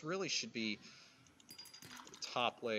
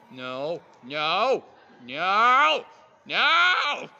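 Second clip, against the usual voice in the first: rising panicked no; 7 semitones higher, 14 dB louder, much slower than usual. Real, not acted. Creature steals her titanium.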